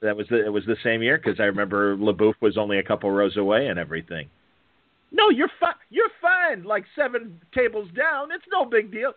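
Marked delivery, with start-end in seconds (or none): 5.1-9.1 very high mocking voice; dismissive Virgil imitation. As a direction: wave off a complaint in a squeaky put-on voice. Imitates Virgil brushing off his complaint.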